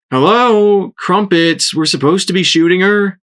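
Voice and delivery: what is wrong with you; exasperated. A narrator's reading. Shrapnel exasperated with Crumpets for not shooting.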